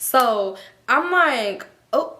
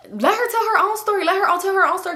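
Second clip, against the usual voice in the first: high insistent friend; 9 semitones higher, 3 dB louder than usual. Retold or imitated. Stank quoted insisting loudly.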